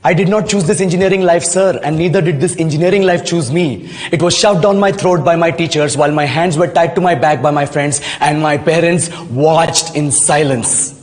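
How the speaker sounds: slow, measured formal English; deliberate English speech. Acted. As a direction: deliver it slowly, as a pointed statement. Answers interviewer in formal English. (ठहर-ठहर कर अंग्रेज़ी में)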